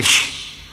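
long hissed shush; reenacted shush. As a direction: hush the complainer. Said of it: "yo le dije".

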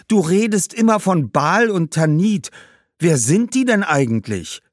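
put-on high, loud young boy; questioning boy. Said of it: "fragte Augustinus". (neugieriger Junge, hohe Stimme)

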